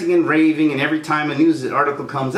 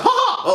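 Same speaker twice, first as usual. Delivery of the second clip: mocking laugh. Real, not acted. Laughing at Mickey Mouse.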